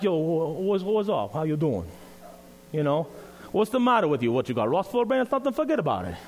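a light New York Italian accent, imitating the men; Italian Goomba accent. Pastor imitating the two Italian men who picked him up, light Italian-American accent. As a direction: toss off a casual Italian-American tough-guy accent. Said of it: "they sounded like Goombas".

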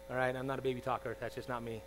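ordinary talk

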